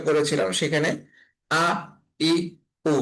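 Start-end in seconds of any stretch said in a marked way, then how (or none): 1.5-2.9 "আ, ই, উ" each struck abruptly at the onset; struck hamza sound. Shown as a demonstration. Teacher showing learners how hamza is sounded, "আ, ই, উ" struck firmly. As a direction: hit "আ", "ই", "উ" as separate hard glottal onsets. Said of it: "এভাবে কিন্তু শব্দটা বাড়ি খেয়ে আদায় হয়েছে".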